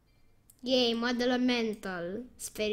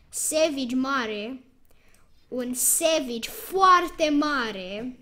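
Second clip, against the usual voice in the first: drawn-out, slow praise; slow savoring; 3 dB louder, much slower than usual. Real, not acted. A player slowly savoring a big Savage card. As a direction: stretch out each word with relish.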